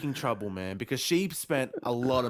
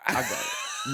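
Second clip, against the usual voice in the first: squeaky laughing boast. Retold or imitated. Mock-cocky brushoff, laughing squeal.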